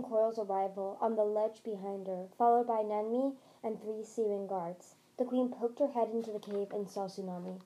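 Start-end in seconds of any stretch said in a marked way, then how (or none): none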